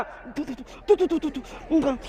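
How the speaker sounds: soft "tu tu" spitting sounds, quick and light; mock spitting. Preacher miming a sleeper spitting on waking, soft mock spits. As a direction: spit out the bad taste in little puffs.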